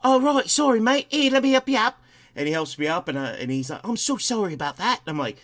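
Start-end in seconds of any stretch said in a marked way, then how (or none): 0.0-2.0 high, loud mock-British apology; British accent. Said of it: "British guy". 3.8-5.0 higher-pitched imitated British apology; British accent. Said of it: "British guy".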